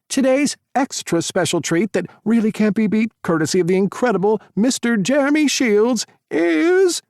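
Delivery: high, loud buildup to a reveal; showman announcer. Acted. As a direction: hold the big pause for effect. Tyler introduces Jeremy.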